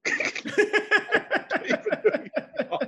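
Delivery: slow drawn-out mock Thanos line; slow laughing Thanos impression. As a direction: draw it out slowly through laughter. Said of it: "have you seen the Thanos meme".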